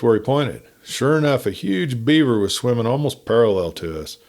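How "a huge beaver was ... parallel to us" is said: usual speaking voice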